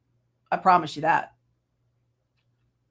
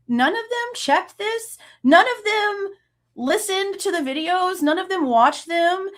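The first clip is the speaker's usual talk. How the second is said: much higher, slow and incredulous; high outraged disbelief; 7 semitones higher, slower than usual. Real, not acted. Slamming the officials to her viewers, high and outraged.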